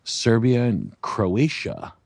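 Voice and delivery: normal talking voice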